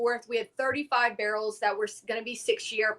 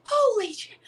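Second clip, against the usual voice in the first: amazed; 9 semitones higher, slower than usual. Retold or imitated. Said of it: "I'm like".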